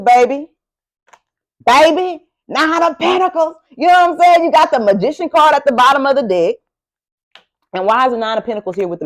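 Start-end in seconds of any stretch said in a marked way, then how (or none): 0.0-6.6 excited. Real, not acted. Thrilled at a card drawn.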